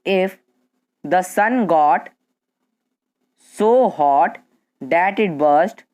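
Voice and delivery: slow, chunked phrase for students to write; slow dictation. Shown as a demonstration. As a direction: spell it out slowly so they can keep up. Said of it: "अगर slow बोले तो". (धीमे-धीमे लिखवाने वाली आवाज़)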